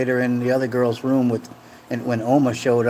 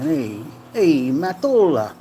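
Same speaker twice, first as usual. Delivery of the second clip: sing-out Italian exclamation; mock Italian accent; 2 semitones higher, slower than usual. Streamer plays up being taken for Italian, mock Italian accent. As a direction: stretch the vowels in cartoon Italian. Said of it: "I am Italian".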